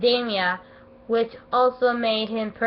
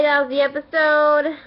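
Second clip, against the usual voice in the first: normal talking voice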